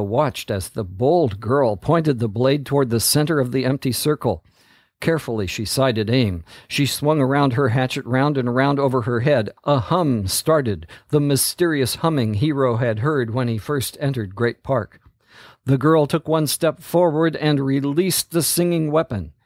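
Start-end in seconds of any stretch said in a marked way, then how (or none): none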